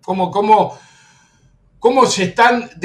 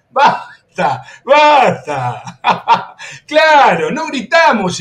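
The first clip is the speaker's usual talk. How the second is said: riendo; 5 semitones higher, 3 dB louder, much slower than usual. Real, not acted. Se ríe del rival.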